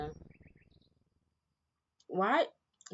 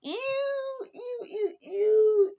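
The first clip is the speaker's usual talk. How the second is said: much higher squealed ews, disgusted; grossed out; 5 dB louder, much slower than usual. Real, not acted. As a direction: squeal every ew in real disgust. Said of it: "I'm kinda icked out".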